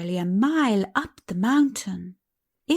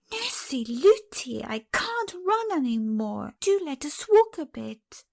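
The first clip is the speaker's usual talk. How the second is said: put-on higher weary little girl begging; tired child pleading; 7 semitones higher than usual. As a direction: plead to stop running.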